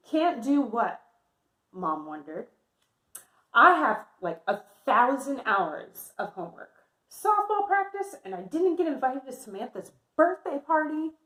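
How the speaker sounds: lively picture-book read-aloud voice; reading to children. Acted. Teacher reading Amelia's story to her class, lively.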